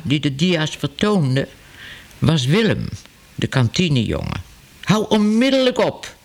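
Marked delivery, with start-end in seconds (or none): 4.8-6.1 1 roepen. A narrator's reading. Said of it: "riep meneer Ellemeet".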